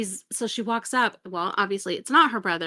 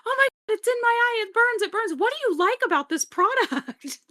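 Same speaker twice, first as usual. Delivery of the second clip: much higher, louder hammed-up wail; mock pained panic; 13 semitones higher, 3 dB louder than usual. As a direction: howl as sunscreen burns her eye. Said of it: "she's like".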